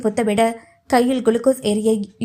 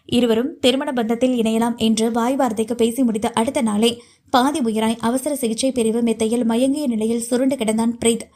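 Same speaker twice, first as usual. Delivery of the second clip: plain reading voice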